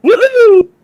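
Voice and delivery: hammed-up celebratory woohoo; mock cheer. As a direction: cheer him on with fake joy. Mock cheering friend's news.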